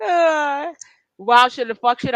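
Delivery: giggly, words tumbling out through laughter; laughing. Real, not acted. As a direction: still laughing as you talk. Cracks up after the gum joke.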